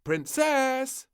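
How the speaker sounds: high, loud call. Acted. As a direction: call her in for lunch. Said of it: "called the maid".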